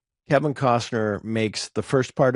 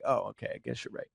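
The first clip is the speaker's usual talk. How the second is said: much softer, trailing into near whisper; sheepish concession; 11 dB quieter than usual. Retold or imitated. Speaker retelling how they conceded to a friend, sheepish. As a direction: give in quietly, retelling it.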